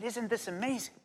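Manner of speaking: high, soft retold amazement; hushed wonder. Recounts marvelling aloud to children.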